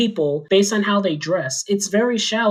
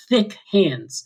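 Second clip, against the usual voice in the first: normal talking voice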